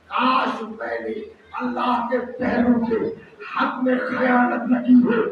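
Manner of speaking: mock cry of regret rising to a shout on خیانت; regretful cry. Preacher voicing a sinner on judgment day, crying out in regret. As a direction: cry out in regret, the last line loud. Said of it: "ایک شخص چیخ کے کہے گا".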